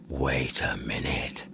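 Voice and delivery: very low, slow near-whisper; dawning realisation. Acted. Searcher spotting a clue in the wallet, hushed. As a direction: breathe it slowly as the clue sinks in.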